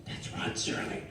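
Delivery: hushed, breathy whisper to his brother. Retold or imitated. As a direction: whisper it so the celebrity can't hear. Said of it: "here's what my brother does".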